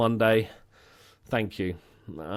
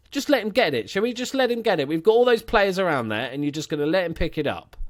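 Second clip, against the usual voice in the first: exasperated complaint, pitched up; 7 semitones higher, 4 dB louder than usual. Real, not acted. Gamer scolding his team for letting him get it, exasperated. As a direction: complain at your own players in exasperation.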